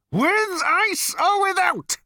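very high, loud, slow bellow. A narrator's reading. Number two bellowing at Ford. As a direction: bellow every word. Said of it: "bellowed number two".

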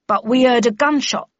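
lightly voiced sentry, explaining himself; apologetic guard. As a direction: call down an excuse to a superior. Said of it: "replied the guard apologetically".

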